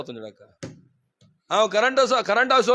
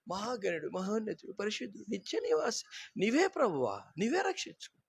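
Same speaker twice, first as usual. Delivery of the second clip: hushed, much softer sing-song fake worship; mock pious prayer; 3 semitones higher, 10 dB quieter than usual. Preacher mocking hypocrites' pious praise, hushed mock prayer. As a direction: parody a hypocrite's breathy praise. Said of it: "దొంగ మాట ఎట్లా మాట్లా".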